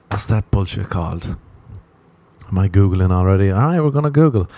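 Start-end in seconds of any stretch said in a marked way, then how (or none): none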